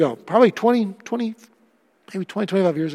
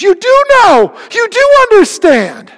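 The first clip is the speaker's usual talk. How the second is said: much higher and louder, bursting with relief; overjoyed exclamation; 12 semitones higher, 8 dB louder than usual. Retold or imitated. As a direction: cry it out like someone finally understood. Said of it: "She goes".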